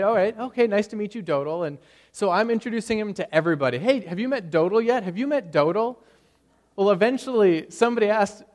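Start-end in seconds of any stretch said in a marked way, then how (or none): 0.0-1.7 high, loud, overly polite hello; awkward polite greeting. Retold or imitated. Pastor politely greeting a newcomer. 3.8-6.0 higher, louder, cheerful calling out; eager introductions. Retold or imitated. Introducing Dodal to everybody.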